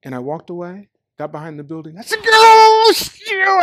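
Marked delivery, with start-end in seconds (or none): 2.2-3.6 high, loud furious shout; went nuts. Retold or imitated. Venting behind the building after failing, furious shout. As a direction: explode with rage, well above the voice. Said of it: "I went nuts".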